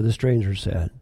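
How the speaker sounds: usual speaking voice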